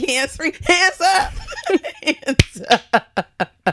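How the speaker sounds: high, slow mock threat breaking into laughter. Retelling how she warned an attacker, high and laughing. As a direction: bark it out then laugh. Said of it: "And I'm like".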